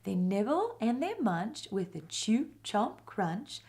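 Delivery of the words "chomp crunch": usual speaking voice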